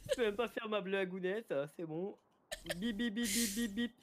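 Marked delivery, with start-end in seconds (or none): 2.8-3.9 high, soft mock reversing beeps; reversing beep imitation. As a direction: beep like a truck backing up. Backing-up beeper imitation.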